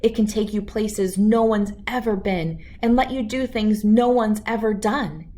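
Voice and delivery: ordinary delivery, nothing marked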